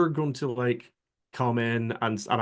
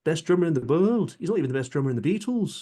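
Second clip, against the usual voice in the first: higher, lilting Scouse quip, quick at the end; Liverpool accent; 6 semitones higher than usual. Acted. Quoting a Beatle answering an interviewer, Liverpool accent. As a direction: toss it back as a cheeky Liverpool one-liner. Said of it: "one line in a Liverpool accent".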